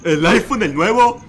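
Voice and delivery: loud, rising question in mock awe; put-on incredulous repeat; mocking echo. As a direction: parrot the thief's brag as a question.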